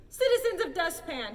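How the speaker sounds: loud public address to the town; loud and clear. A narrator's reading. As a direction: call the crowd to order. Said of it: "spoke loud and clear".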